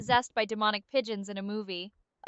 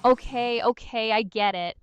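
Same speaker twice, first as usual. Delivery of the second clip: ordinary talk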